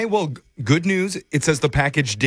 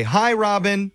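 raised, higher-pitched demo of a call; calling out loudly; 9 semitones higher, slower than usual. Shown as a demonstration. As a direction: show her how to hail Robin. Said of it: "loudly say".

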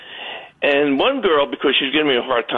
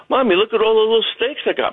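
put-on high excited little boy; child's voice; 7 semitones higher, faster than usual. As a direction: squeal it like a small kid. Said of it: "and I say".

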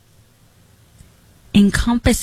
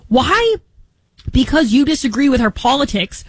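indignant outburst; 6 semitones higher than usual. Real, not acted. Challenging the cruel critics, indignant and high. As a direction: burst out with an incredulous why.

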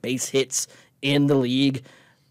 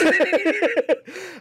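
high squeaky burst of laughter; laughing. Real, not acted. Podcast co-host bursts into high laughter at a joke.

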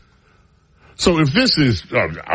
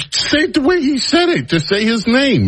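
urging, high; 13 semitones higher than usual. Real, not acted. Host goading a producer on air, high and insistent. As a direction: voice jumps high, pushing him to say it. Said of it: "pressing a coworker on air".

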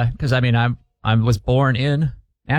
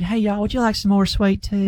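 put-on a light sugary Southern belle accent, higher; sweet Southern belle; 8 semitones higher than usual. Guest offering sweet tea to visitors, in a light Southern belle accent. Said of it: "There's a difference between".

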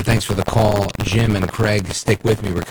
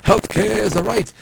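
mocking Bernie voice, pitched way up; a hammed-up Bernie Sanders, much higher than his own voice; Bernie Sanders impression; 12 semitones higher than usual. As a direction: parody the senator's stump line, high and preachy. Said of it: "Bernard Sanders".

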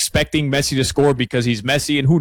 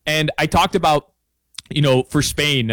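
ordinary delivery

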